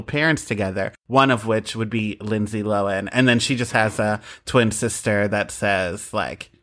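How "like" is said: ordinary talk, nothing marked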